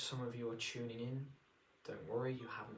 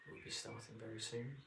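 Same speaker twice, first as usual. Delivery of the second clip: ordinary delivery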